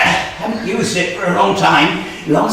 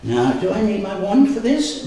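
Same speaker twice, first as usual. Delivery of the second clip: ordinary talk, nothing marked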